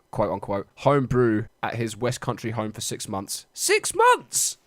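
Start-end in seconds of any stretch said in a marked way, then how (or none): 3.5-4.6 shocked, much higher; incredulous. Real, not acted. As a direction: cry out in disbelief. Incredulous at sentence.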